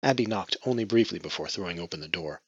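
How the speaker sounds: usual speaking voice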